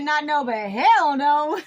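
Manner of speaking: loud, slowing into a laugh on hell no; defiant refusal. Retold or imitated. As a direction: slam the door on them, laughing. Ancestors refusing the sacrifice.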